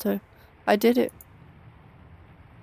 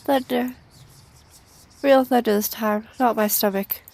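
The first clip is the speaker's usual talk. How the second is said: ordinary talk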